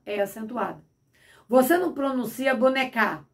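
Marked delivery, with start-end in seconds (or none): 2.5-3.2 "bonecar" as bo-ne-CÁ, stress on the final A; "bonecar" said as an oxytone, ending in stressed CÁ; stress on last syllable. Shown as a demonstration. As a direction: push the stress onto the end of "bonecar". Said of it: "no A, porque toda palavra oxítona".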